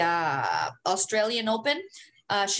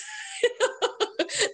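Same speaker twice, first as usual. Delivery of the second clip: high giggling burst; laugh; 14 semitones higher than usual. Real, not acted. Laughs at own confession.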